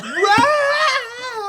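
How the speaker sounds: high, loud drawn-out wail; hammed-up comic cry; imitated wail. Retelling friend with bean sandwich, loud mock wail.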